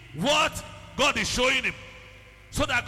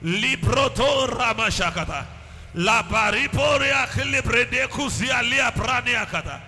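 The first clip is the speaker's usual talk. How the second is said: louder, surging cries to the Father then tongues; loud fervent prayer; 6 dB louder than usual. Acted. Pastor leading the church to cry for God's attention, shouting. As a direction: plead and build into full tongues.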